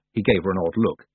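ordinary delivery, nothing marked

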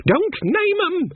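high, sharp warning snap; sharp old witch. A narrator's reading. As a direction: cut her off, shrill and urgent. Granny cuts Nanny off sharply.

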